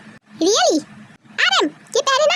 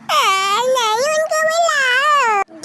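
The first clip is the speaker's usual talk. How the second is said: put-on crying child; 4 semitones higher, much slower than usual. Child cries for uncle.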